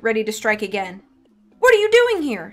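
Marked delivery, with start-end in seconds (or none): 1.6-2.5 high, louder startled demand. A narrator's reading. Scared young woman confronts two intruders, high-pitched demand. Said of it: "she demanded".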